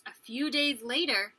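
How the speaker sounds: ordinary delivery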